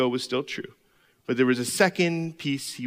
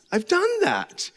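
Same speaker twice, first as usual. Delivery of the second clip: I've done that pitched much higher, slow and exasperated; exasperated repeat; 14 semitones higher, slower than usual. Retold or imitated. Pastor complaining to God that he's done that, exasperated. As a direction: rise high on done, fed up. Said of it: "Why isn't this getting any better?".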